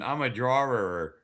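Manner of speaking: Maine accent. Shown as a demonstration. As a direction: say drawerer like a Mainer. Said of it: "you have to say it properly".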